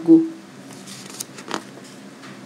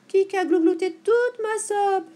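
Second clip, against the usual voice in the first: high, slow, loud whine; drawn-out, high-pitched whimpering complaint; tearful little bear; 11 semitones higher, 7 dB louder, slower than usual. A narrator's reading. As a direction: wail it like a crying baby bear. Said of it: "pleure Chignache Petit Ours".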